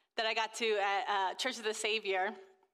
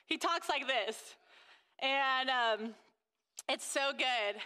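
usual speaking voice

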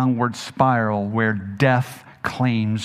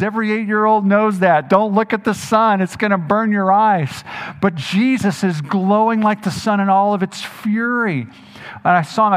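loud, higher, driving sermon voice; preaching at full force; 6 semitones higher, 8 dB louder than usual. Acted.

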